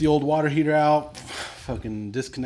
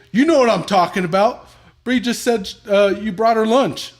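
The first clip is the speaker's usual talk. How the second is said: high, loud mock yell; imitating angry son; 9 semitones higher, 9 dB louder than usual. Son yelling at dad over the phone. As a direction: shout it like a betrayed son. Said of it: "angry son on phone".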